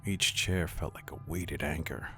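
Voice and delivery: usual speaking voice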